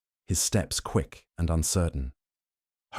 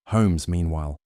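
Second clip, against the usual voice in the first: plain reading voice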